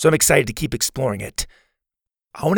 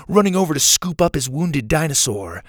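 ordinary delivery

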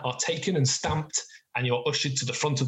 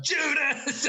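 high-pitched heckle at ordinary volume; mock shout; 13 semitones higher than usual. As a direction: imitate a crowd heckler, voice thrown high. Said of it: "you start to shout".

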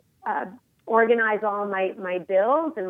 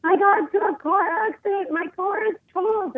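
much higher, fast, panicked mock wail; imitating hysterical mom; 11 semitones higher, faster than usual. Mom calling after her car accident, mock hysterical and high. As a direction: replay mom's frantic call, pitched way up. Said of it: "she was hysterical".

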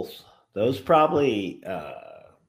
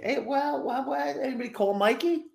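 high mock buddy voice; faster than usual. Imitates buddies calling him.

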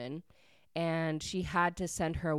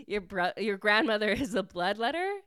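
confused disbelief; 4 semitones higher, 6 dB louder than usual. Retold or imitated. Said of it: "Grace is so confused".

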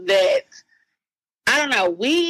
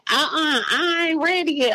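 put-on whiny child; 7 semitones higher than usual. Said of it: "and she like".

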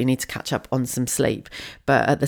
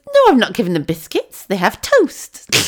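indignant mum; 7 semitones higher, 6 dB louder than usual. Retold or imitated. Mum to daughter about toast, indignant put-on voice. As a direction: let mum insist proudly. Mum's defence, acted.

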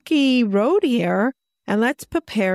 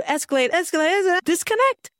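each word climbing far higher; escalating; 8 semitones higher, faster than usual. Shown as a demonstration. Showing how fights used to escalate, pitch rising. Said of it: "escalating pitch demo".

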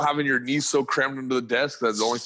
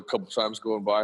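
ordinary talk, nothing marked

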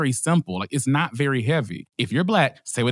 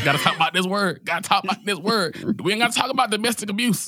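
mock outrage climbing higher in pitch; mock indignant rant; 6 semitones higher than usual. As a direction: rant in exasperation, each phrase higher. Mock tirade about misplaced priorities.

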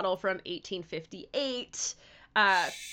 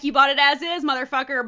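dismissive retort; 5 semitones higher, 9 dB louder, faster than usual. Retold or imitated. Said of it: "They're like".

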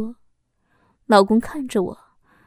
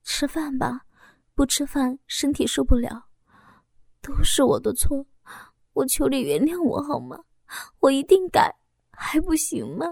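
tender pleading; 3 semitones higher than usual. Acted. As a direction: soothe him and beg forgiveness. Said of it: "温柔地说着". (妻子温柔愧疚地求丈夫原谅)

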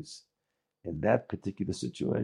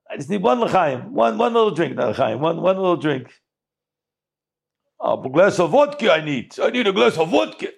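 high, loud begging for a drink; pleading governor; 8 semitones higher, 7 dB louder than usual. Acted. Governor loudly begs the innkeeper for vodka, high and pushy. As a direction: press him hard for one more vodka.

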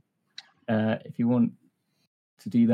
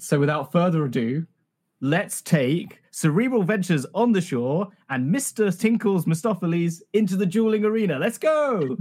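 hype announcer; 5 semitones higher than usual. Acted. Hypes the duel like an announcer.

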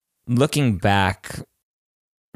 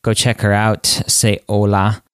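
louder upbeat outro read; podcast outro; 4 dB louder than usual. Acted. Host signing off the podcast, announcer read. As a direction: wrap up the show like an announcer.

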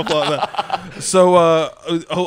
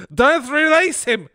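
high, loud, drawn-out scream; 10 semitones higher, 4 dB louder, slower than usual. Acted. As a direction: scream the warning, stretched and urgent. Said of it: "she screamed".

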